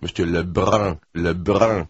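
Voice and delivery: "Lebrun" with a throaty French r and nasal vowel; French pronunciation. Acted. Translator correcting a host's accent, exaggerated French "Lebrun". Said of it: "Against your palate".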